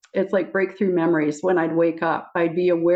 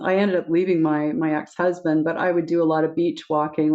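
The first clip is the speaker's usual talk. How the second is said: ordinary talk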